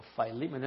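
Philemon said "FYE-lee-men"; English name variant. Shown as a demonstration. Preacher repeats the option, Philemon as "FYE-lee-men". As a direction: open Philemon with a "fye" syllable. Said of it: "otros dicen Philemon".

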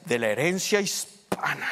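ordinary talk, nothing marked

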